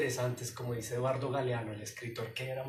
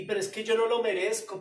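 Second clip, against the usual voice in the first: Peter's voice; 6 semitones higher, 6 dB louder than usual. Retold or imitated. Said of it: "pensaría Pedro".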